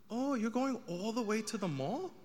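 a light take on a surprised mother; imitating his mom.

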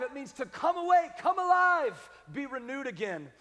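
high, loud, building preaching; rousing. Real, not acted. Preacher explaining revive to congregation, rousing. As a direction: build to a ringing high call.

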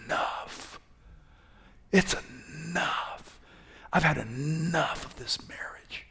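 soft, slow whisper of despair; worn-out whisper of surrender; exhausted whisper. Acted. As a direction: whisper like you're giving up. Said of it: "he prayed that he might die".

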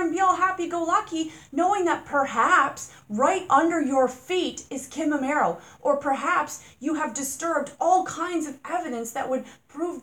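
high, loud, indignant rant; furious loud questioning of the owner. Real, not acted. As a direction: hammer the accusation home. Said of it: "Didn't mean to rant".

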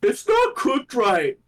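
slow, high put-on complaint; mock complaining customer. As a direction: drag it out like a fussy critic. Quoting the guy at the end.

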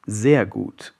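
plain reading voice

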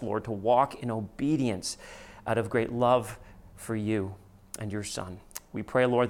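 low, hushed pastoral prayer. Acted. Opening prayer before sermon.

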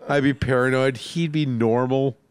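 ordinary delivery

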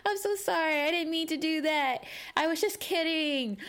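high, over-the-top mock apology; imitating a panicked coworker apologising over and over; frantic apology. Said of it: "He was like".

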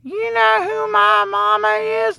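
put-on very high, whiny spoiled kid; mock a kid name-dropping his mama; squeaky, high show-off; entitled brat voice. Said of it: "don't lead in there with".

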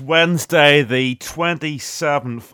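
loud, slow announcer intro; radio announcer. Acted. Radio show opening announcement.

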